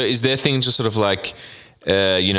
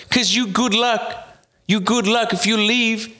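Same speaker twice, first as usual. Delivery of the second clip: mock vendor pleading; 9 semitones higher than usual. Vendor pleading about luck.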